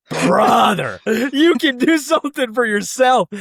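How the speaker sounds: high-pitched retold protest; mock exasperation. Older brother answers the endless hunger complaint, mock exasperated. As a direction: imitate a fed-up brother, voice climbing.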